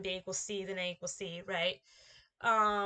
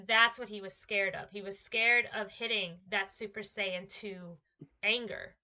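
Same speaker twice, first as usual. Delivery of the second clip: ordinary delivery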